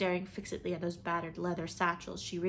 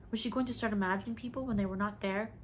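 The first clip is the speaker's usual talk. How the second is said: ordinary delivery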